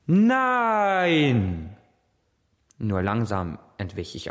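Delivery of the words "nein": high, loud cry of horror; scream. Acted. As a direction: cry out in terror from the dream. Schrei aus dem Traum. (lauter, hoher Entsetzensschrei)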